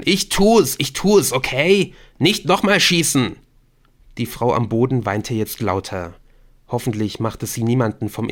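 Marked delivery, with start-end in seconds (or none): 0.0-3.4 high, loud frantic surrender; terrified, high-pitched pleading shout; panicked yell. A narrator's reading. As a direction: shout your compliance after a gunshot. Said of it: "brüllte Ortolan".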